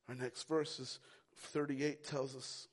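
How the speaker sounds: ordinary delivery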